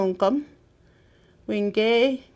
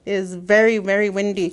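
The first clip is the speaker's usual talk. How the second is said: usual speaking voice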